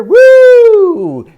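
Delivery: high, loud joyful woo; excited woo. Acted. Storyteller whooping as Beagle goes in, excited. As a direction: whoop like a ride. Following Beagle through building.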